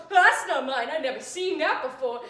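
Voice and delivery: loud protesting perp; loud denial. Retold or imitated. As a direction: protest it loudly. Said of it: "And he goes".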